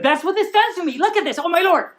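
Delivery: excited. Real, not acted. Excited reaction after reading.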